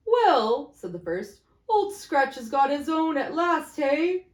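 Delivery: high, loud, gleeful gossip; gloating businessman. A narrator's reading. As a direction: relish the news.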